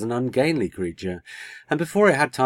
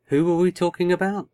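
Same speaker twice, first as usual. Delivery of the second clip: high, vague, to herself; dreamy; 10 semitones higher, 5 dB louder than usual. A narrator's reading. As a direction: wonder aloud absently. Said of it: "almost to herself".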